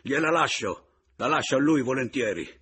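loud, high, emphatic concession; shouting. A narrator's reading. Man gladly leaves wife to rival, shouting.

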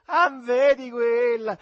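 high, loud, drawn-out mock lecture; didactic yelling. A narrator's reading. Jeering at the soldier through cupped hands, didactic shout. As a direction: bellow it like a mocking guide. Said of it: "urlando con aria didascalica".

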